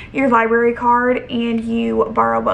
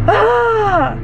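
sudden high exasperated cry; frustrated yell; 9 semitones higher, 4 dB louder than usual. Real, not acted. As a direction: let out a burst of frustration. Frustrated outburst after anxious day.

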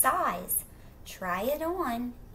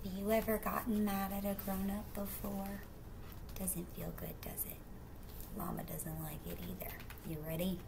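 hushed, gentle whisper; hushed aside; 9 dB quieter, faster than usual. Real, not acted. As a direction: lean in and whisper. Quiet aside to children.

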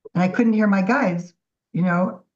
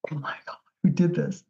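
whisper; 3 semitones lower, 5 dB quieter than usual. Retold or imitated. Awestruck at her own painting.

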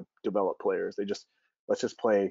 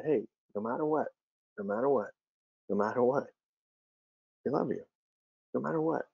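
soothing dad; 2 semitones higher than usual. Retold or imitated. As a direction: gently soothe a crying child. Father comforting crying son.

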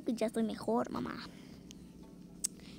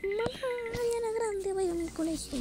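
excited; 12 semitones higher than usual. Pretend. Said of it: "De seguro le digo".

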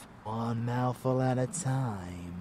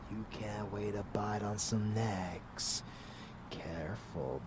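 ordinary talk

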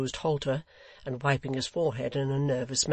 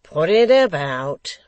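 1 rousing call; 3 semitones higher, 11 dB louder, slower than usual. A narrator's reading. Hugh urges drink around.

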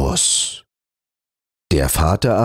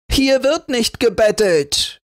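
much higher, snapping rejection; yelling. A narrator's reading. Naseweiß driving the beggar away, angry. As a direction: slam the door on the beggar. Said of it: "schrie Naseweiß".

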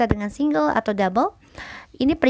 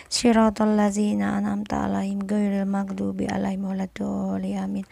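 quiet, murmured Quran recitation; prayer recitation; 4 dB quieter than usual. Acted. Recites al-Fatihah before reading.

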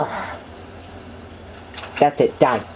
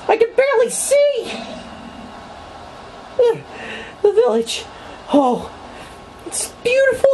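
tiny high voice; 3 dB quieter than usual. Pretend. Lost in dark village.

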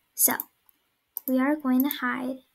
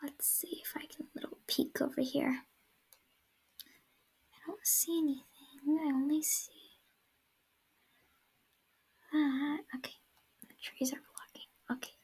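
whispering; 13 dB quieter, faster than usual. Real, not acted. Hiding, peeking at seeker.

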